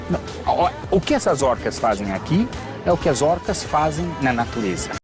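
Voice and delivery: loud amplified arena announcer; show announcer. Real, not acted. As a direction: call it out to the stands. Presenter addressing a show crowd.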